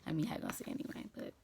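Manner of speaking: hushed unvoiced whisper to the camera. Real, not acted. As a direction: whisper so nobody in the house hears. Whispering indoors.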